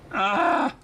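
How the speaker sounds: ordinary talk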